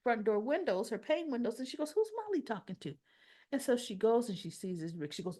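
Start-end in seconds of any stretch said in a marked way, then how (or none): 1.9-2.9 high, fast, curious mother asking; puzzled high question. Retold or imitated. Mother at the window asking who her daughter talks to, high and quick. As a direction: wonder aloud who Molly's talking to.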